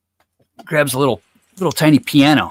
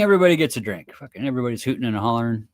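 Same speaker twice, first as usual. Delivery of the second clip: ordinary talk, nothing marked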